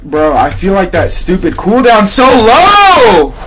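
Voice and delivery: high, loud, drawn-out complaint; exasperated shout. Real, not acted. Player complaining about a slow cooldown, exasperated shouting. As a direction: wail it loudly, stretching the words.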